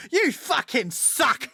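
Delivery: mock furious yell, high and drawn out; Gordon Ramsay. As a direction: berate them slowly in an exaggerated chef's rage. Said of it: "with adults and he's just like".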